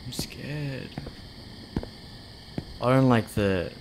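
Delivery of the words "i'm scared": breathy whispered admission of fear; scared whisper. Real, not acted. Streamer alone in a dark store, whispering in fear. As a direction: whisper it as if something might hear.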